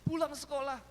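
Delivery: put-on high, loud call to the kids; imitated mother hollering. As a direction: yell orders across the house. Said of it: "Kalau manggil anak-anak, panggilnya terus". (menirukan ibu memanggil anak dengan lantang)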